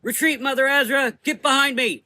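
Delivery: high, loud warning; urgent yell. A narrator's reading. Rose protecting Mother Azra, urgent yell. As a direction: yell her back to safety. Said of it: "she yelled".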